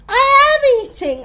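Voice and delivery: very loud, high-pitched insistence; heated, full-volume protest; outraged protest. A narrator's reading. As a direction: shout the denial indignantly. Accused girl protests, more heated.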